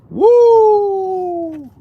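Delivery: high, loud whoop of delight; excited whoop. Real, not acted. Streamer cheering his oiled beard shine with a whoop. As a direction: let out a big happy woo.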